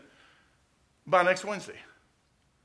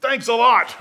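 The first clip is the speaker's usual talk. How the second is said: louder, punched sarcastic thanks; preacher retelling his louder reply to the Lord; 7 semitones higher, 9 dB louder than usual. Retold or imitated. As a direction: say it back with a loud sarcastic edge. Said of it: "I said".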